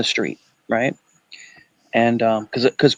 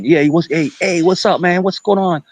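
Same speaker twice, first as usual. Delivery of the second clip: a higher, revved-up New Yorker greeting; New York accent; 7 semitones higher than usual. Retold or imitated. Dad greeting his son, lightly voiced New York accent. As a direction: slip into a Queens voice. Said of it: "I sound like a New Yorker again".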